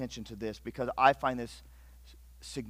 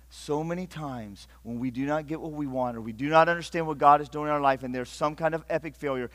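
ordinary talk

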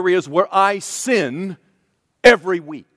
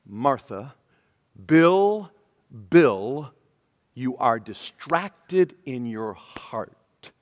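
softer, slow, gentle calling of names; tender plea; 6 semitones lower, 5 dB quieter, much slower than usual. Acted. The Lord pleading with Martha, softer and slow. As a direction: coax them back softly. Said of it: "And he says".

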